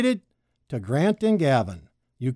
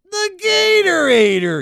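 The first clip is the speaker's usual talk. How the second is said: grinning snake; 13 semitones higher, 6 dB louder than usual. A narrator's reading. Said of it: "he said with a grin".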